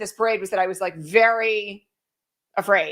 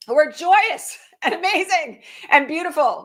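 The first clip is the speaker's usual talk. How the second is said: delighted, laughing; 7 semitones higher than usual. Real, not acted. Gushing about dancers with a laugh.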